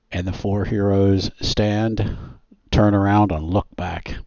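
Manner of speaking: normal talking voice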